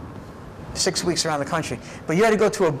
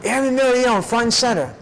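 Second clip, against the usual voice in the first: drill sergeant call; 7 semitones higher than usual. Retold or imitated. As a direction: bark the name out to the ranks. Sergeant calls the airman forward.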